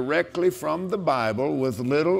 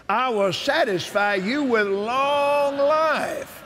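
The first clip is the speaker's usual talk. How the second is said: preaching at full force; 8 semitones higher, slower than usual. Acted. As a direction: shout the promise like from the pulpit.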